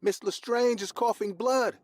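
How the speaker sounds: panicked crying. A narrator's reading. Young boy crying out to the older kids, panicked. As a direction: wail the alarm in terror. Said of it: "started crying".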